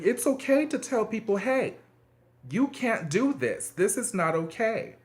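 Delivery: encouraging parent. Retold or imitated. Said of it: "When you empower your child saying".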